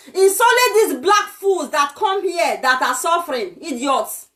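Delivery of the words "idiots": normal talking voice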